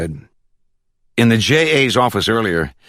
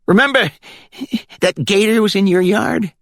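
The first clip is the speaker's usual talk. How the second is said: put-on country accent from the Glades. A narrator's reading. Caller on speakerphone checking for the judge, high country accent. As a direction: ask it in a high rural Florida accent. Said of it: "With that country accent".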